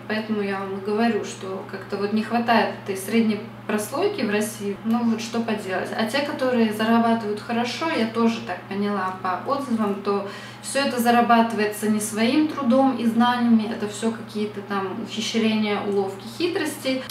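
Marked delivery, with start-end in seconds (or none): none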